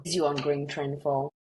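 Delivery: ordinary talk, nothing marked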